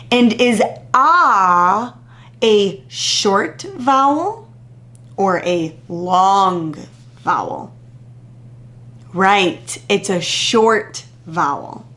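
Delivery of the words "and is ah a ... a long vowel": slow, drawn-out question; patient teacher prompt. Shown as a demonstration. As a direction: stretch each word for the class. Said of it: "What sound do you hear".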